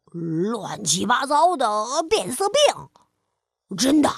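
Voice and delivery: low, slow lion, worried; lion asking in alarm; startled lion. Acted. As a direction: doubt it like a scared lion. Frightened lion asks.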